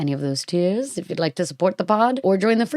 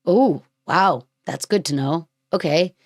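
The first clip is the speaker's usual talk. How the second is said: ordinary delivery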